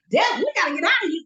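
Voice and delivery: high, fast mock-panicked plea; retold panic. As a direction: urge a friend to flee, voice jumping up high. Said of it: "when I panic".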